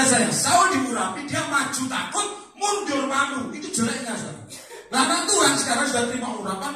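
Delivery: loud, high, driving sermon; fiery preaching. Acted. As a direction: preach it hard to the congregation. Preacher at full force. (khotbah berapi-api, keras dan tinggi)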